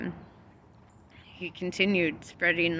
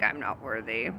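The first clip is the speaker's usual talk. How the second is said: meek low mumble; 9 semitones lower, 7 dB quieter than usual. Retold or imitated. Peter's self-doubt retold.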